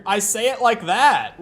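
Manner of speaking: loud, defensive comeback; indignant. Real, not acted. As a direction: insist on it, affronted. Indignant reply to being called weird.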